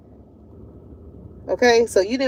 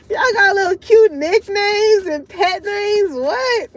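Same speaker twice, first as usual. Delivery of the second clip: higher, slow teasing question; mock amazement; 8 semitones higher, 3 dB louder, slower than usual. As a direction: tease them about pet names. Friends teasing about pet names.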